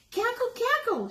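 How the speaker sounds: high cackling hen voice; clucking hen laugh. Acted. Mother hen clucks and laughs at Hedgie, high cackle. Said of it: "she clucked and laughed".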